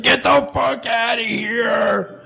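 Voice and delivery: high, drawn-out retold yell; mock angry outburst. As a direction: bellow it slowly in mock outrage. Acts out angry reply on waking.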